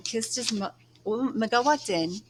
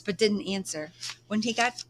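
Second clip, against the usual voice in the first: ordinary delivery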